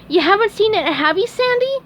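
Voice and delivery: very high, hopeful sponge voice; high squeaky hoping question; hopeful SpongeBob. A narrator's reading. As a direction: plead a little. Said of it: "SpongeBob asked hopefully".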